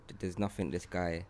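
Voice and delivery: usual speaking voice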